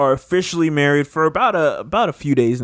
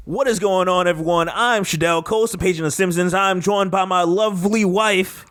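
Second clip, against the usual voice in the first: upbeat, higher show-opening voice; podcast intro; 4 semitones higher than usual. Acted. Host welcoming listeners and introducing new wife, upbeat announcing.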